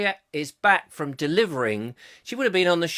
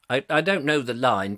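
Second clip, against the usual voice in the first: ordinary talk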